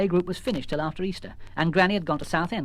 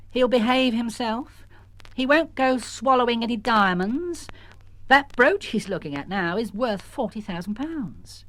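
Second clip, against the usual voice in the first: higher, slow assured voice; mother; 7 semitones higher, slower than usual. Acted. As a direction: reassure firmly. Mrs Jones reassuring.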